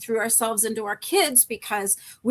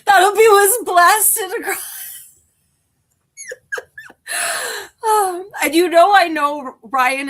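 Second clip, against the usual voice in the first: high, loud and tearful; real crying mixed with laughter; laughing through tears; 8 semitones higher, 10 dB louder, slower than usual. Real, not acted. Vlogger imagining her tears blasted across, laughing through tears.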